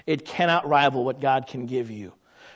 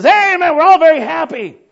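much higher and louder, cheering crowd; happy congregation; 11 semitones higher, 9 dB louder than usual. Acted. Congregation answering the preacher, cheerful. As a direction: answer the pulpit with glee.

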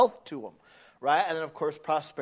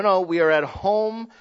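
ordinary delivery

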